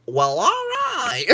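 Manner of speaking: loud high drawn out cry; excited outcry. Real, not acted. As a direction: whoop it out slow and high. Cries out laughing.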